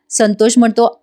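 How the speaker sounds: usual speaking voice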